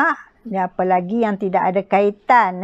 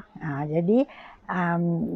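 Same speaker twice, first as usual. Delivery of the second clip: normal talking voice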